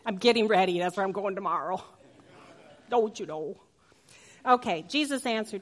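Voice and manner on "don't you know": Minnesota lilt on "Don't you know?", long rounded "know"; playful Minnesota accent. Acted. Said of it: "Little Minnesota there".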